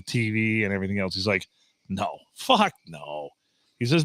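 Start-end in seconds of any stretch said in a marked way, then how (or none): 1.9-3.3 deep, slow, dragged-out local guy; low gruff local. Retold or imitated. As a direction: drop the voice low and slow for a blunt southern local. Barbecue guy's blunt refusal retold.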